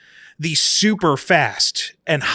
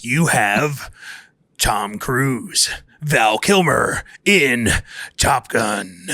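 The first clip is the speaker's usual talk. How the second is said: movie announcer voice; 3 semitones lower than usual. Pretend. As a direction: boom it out like a film trailer. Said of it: "my movie announcer voice".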